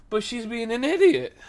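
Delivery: high-pitched, indignant retold complaint; out-loud protest. Worker protesting to the manager about a customer, blurted out loud. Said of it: "I said that out loud".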